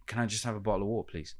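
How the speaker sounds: fast British accent, words running together; rapid British accent. Shown as a demonstration. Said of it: "I'll just say".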